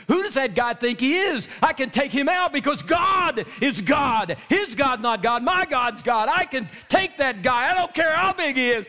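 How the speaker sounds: triumphant shouted boast; bold defiant boast. Acted. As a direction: cry it out like a young fighter sure of God. David's bold defiant boast voiced.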